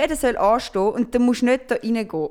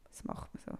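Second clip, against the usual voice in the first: whispered aside; 23 dB quieter than usual. Real, not acted. Aside whisper about queueing. (geflüstert)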